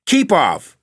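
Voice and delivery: loud, high exclamation; warding off. A narrator's reading. The Baron repels the gnashing figure, loud warning. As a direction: fend someone off with a high, forceful burst.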